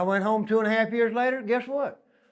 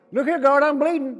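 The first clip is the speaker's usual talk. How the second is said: loud, high cry for help to the guard; a stabbed inmate calling out loud to the guard; 8 semitones higher, 7 dB louder than usual. Retold or imitated. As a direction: yell for the guard, wounded.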